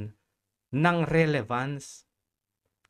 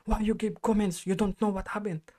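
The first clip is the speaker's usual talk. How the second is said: higher-pitched mock telling-off; mildly raised, accusing voice; imitated scolding shout; 4 semitones higher than usual. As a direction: retell the manager's accusing outburst. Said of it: "Sinigawan ba naman ako?".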